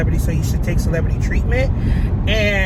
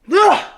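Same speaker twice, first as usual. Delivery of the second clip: loud, high acted groan; exasperated ugh; 12 semitones higher, 9 dB louder than usual. Acted breaking away from kiss.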